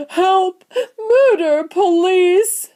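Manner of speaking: very high, loud alarm cries; panicked shrieks; screaming. A narrator's reading. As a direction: scream for help hysterically. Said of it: "screamed Missus Gloop".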